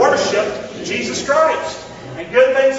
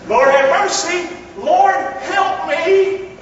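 loud prayer; 7 semitones higher, 3 dB louder, much slower than usual. Acted. Preacher models the prayer.

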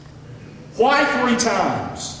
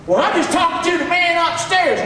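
high, put-on mimicking voice; mocking casual worshipper; 6 semitones higher than usual. Preacher mimics someone praying too casually, mocking. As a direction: mimic a flippant churchgoer, pitched well up.

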